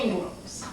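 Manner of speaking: "Ingrams" nasal-free but clipped to "Ayngros"; southern Ingrams. Shown as a demonstration. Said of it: "that southern thing".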